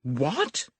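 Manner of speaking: put-on girl crying out in surprise; astonished cry. A narrator's reading. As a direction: gasp it out in shock. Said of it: "A cry of astonishment".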